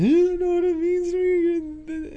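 high-pitched, loud mock sobbing wail; hammed-up tearful squeal; imitated crying. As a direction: imitate an overwhelmed fan wailing through tears. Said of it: "she's crying into his huge chest".

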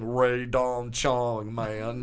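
put-on very slow, drawn-out manager's accent; Barry Katz accent. Comedian mimicking manager's favourite line, drawn-out accent. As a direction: drag out every word of the name. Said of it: "just my manager going".

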